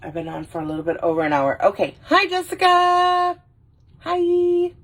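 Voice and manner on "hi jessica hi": high, drawn-out hello; high-pitched, stretched greeting to a friend; excited greeting. Real, not acted. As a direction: sing out a delighted hello. Greets a viewer joining the stream.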